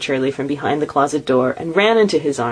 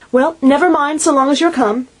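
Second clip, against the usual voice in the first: much higher, louder motherly reassurance; soothing mother; 11 semitones higher, 4 dB louder than usual. A narrator's reading. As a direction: wave the lateness away. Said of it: "said Missus Cratchit".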